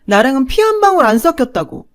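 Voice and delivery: cruel fast shout. Acted. Mother tells daughter they share no blood, fast cruel shout. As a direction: spit out 방울 with a falling blow, fast.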